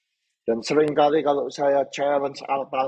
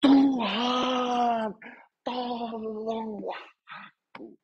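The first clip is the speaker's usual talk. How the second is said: crying out; 9 semitones higher, much slower than usual. Acted. Peter sinking in the water cries to the Lord, crying out. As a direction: call out desperately, stretching each word. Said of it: "untuk berteriak".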